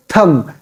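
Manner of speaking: "thumb" with a silent b; "thumb" with no b at the end; corrected thumb. Shown as a demonstration. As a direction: say "thumb" as tum, ending on m. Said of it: "B is silent here".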